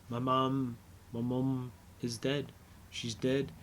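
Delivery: a touch of someone breaking down, softly; quiet breaking down. Retold or imitated. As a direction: let it drop to a hush, like breaking down. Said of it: "Steven starts breaking down".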